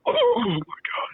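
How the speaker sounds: high, slow, strained cry; pleading in desperation; panicked. Retold or imitated. Desperate need for toilet.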